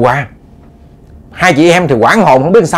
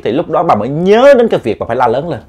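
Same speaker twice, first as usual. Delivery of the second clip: ordinary delivery, nothing marked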